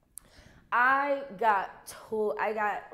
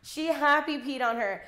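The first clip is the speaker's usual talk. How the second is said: usual speaking voice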